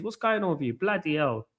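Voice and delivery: ordinary talk, nothing marked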